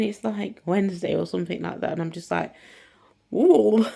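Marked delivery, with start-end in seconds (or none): 3.3-3.9 loud high ooh of delight; mock thrilled ooh, much louder; excited ooh. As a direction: squeal with hope. Delighted reaction.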